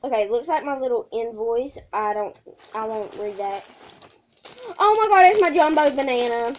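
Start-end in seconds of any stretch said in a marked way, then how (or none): 4.8-6.5 excited. Real, not acted. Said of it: "Sorry if I squeal a lot".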